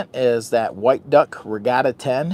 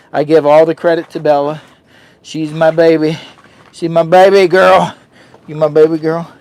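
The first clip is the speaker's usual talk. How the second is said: adoring praise; 4 semitones higher, 10 dB louder than usual. Real, not acted. Loud adoring praise of dog.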